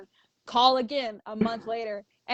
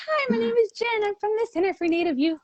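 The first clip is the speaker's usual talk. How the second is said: bright caller voice; 10 semitones higher than usual. Retold or imitated. Voicing Jen's voicemail greeting.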